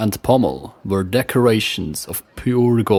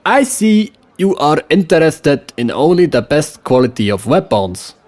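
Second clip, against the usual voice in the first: put-on smooth salesman in a West Frankish accent, pitched higher; 5 semitones higher, 4 dB louder than usual. A narrator's reading. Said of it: "with a West Frankish accent".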